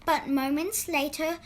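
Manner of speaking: plain reading voice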